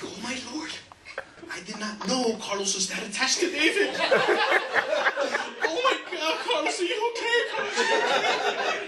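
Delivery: high fussing lady's voice; worried church lady. Retold or imitated. Church lady at the funeral fussing, high and worried. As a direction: fuss over him high and breathless. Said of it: "this one lady comes like".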